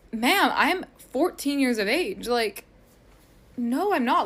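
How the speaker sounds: slow, emphatic mock indignation; acted-out offended denial; retold indignation. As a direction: push back with sassy, spaced-out words. Acts out indignant denial of accusation.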